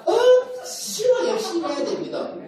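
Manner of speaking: 억지로 sung out high and slow with a laugh; playful Gyeongsang emphasis. Shown as a demonstration. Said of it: "경상도 말로 하면 억지로 쉬운 거고". (웃으며 높고 느리게 끄는 경상도식 강조)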